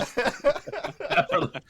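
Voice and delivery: drawn out high through laughter; laughing while stretching the word. Real, not acted. Teasing friend about his voice.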